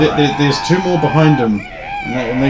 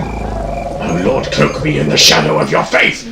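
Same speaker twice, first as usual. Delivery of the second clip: lower, murmured dark prayer; dark priest incantation; 11 semitones lower, slower than usual. Acted. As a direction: intone like a cultist. Dark priest casting spell.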